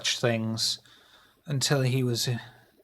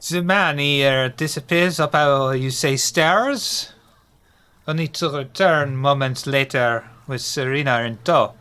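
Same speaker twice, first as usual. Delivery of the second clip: narrating in a hammed-up French accent; 2 semitones higher, 4 dB louder than usual. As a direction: tell it like a clumsy Frenchman. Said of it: "That was terrible".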